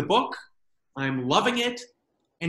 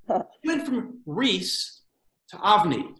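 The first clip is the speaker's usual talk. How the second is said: drawn-out names; slower than usual. Shown as a demonstration. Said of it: "drawn-out name change".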